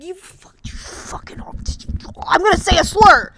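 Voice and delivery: whispered then loud mock fury; hammed-up strangled rage; mock furious outburst. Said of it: "you're like".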